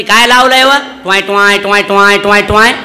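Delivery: loud, sleepy village wife grumbling back; grumpy wife's reply. Retold or imitated. Woken wife loudly complains at husband's shouting. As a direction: snap back irritated, mocking his shouts. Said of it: "तीन बोलती".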